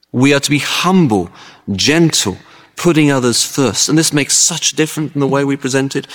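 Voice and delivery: ordinary delivery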